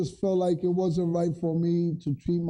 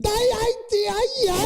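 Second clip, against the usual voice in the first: mock Chinese yelling. Friend mocking Chinese speech as angry, in a much higher mock Chinese yell. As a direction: squeal it like a caricature of yelling in Chinese. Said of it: "they be, like, yelling at you".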